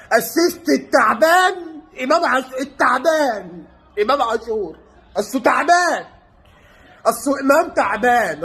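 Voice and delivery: normal talking voice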